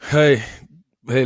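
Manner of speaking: usual speaking voice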